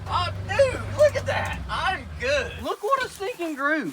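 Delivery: high, thrilled whooping; real excitement. Real, not acted. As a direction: burst out, amazed at your own shot. Thrilled at his target grouping.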